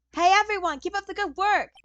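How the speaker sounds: high, loud greeting to a whole crew; cheery calling out. Acted. Character calls out to investigators.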